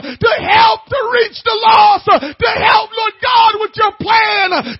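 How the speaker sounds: high, loud, tearful shouted plea; crying-out prayer. Pretend. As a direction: cry out to God with tears. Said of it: "I'm moaning and groaning and says".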